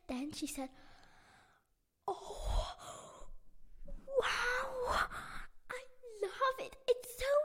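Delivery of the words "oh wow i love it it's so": high, loud delighted squeal with a laugh; girl overjoyed by her new costume, laughing; thrilled squeal. Acted. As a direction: gush over the costume, giggling.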